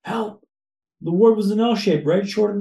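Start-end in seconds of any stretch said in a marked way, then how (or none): 0.0-0.5 mild mock call for help. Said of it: "I'm going".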